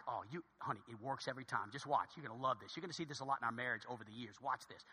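low, hushed confident aside; confidential aside. Acted. Husband letting his new wife in on his plan, low confidential aside. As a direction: murmur it low, sure of your trick.

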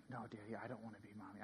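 fast, very soft, low murmur; hushed quote of a daughter protesting; retold child's denial. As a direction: rattle it off quietly as the child's reply. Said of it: "our four-year-old".

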